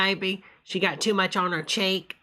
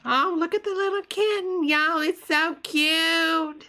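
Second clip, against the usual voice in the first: cooing at a kitten; 9 semitones higher, slower than usual. Real, not acted.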